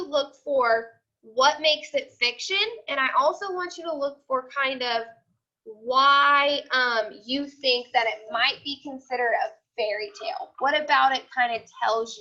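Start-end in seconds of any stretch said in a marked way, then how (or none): none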